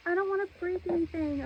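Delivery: quick soft worried mumble; quiet. Real, not acted. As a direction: mutter it fast under your breath.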